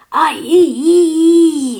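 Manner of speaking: high, loud, drawn-out squeal; lizard's shriek. A narrator's reading. Lizard has coffee spilled on him, shrieking. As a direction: shriek in rising pain. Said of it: "hissed Don Lagarto".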